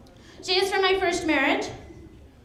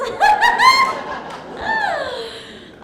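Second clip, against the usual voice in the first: loud, very high burst of laughter; shrieking laugh; 5 dB louder than usual. Acted. Wife joking about husband's boyfriend to rival, high shrieking laugh. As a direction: let out a long high laugh, tailing off.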